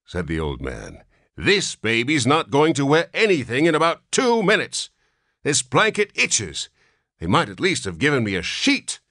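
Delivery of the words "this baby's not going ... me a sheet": put-on grumpy old man. A narrator's reading. Old man refuses blanket.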